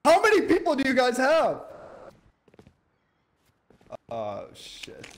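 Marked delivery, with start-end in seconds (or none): 0.1-1.6 high, shouted question; loud demand. Real, not acted. Player confronting strangers over their numbers, loud demand. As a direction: demand it from the other team, loudly. Alarmed demand at other team.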